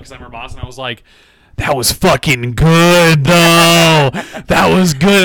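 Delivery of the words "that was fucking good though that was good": loud, drawn-out, higher-pitched holler; retold delighted holler. Boss reacting to an employee's joke about them, delighted holler. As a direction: crow it out with huge, stretched delight.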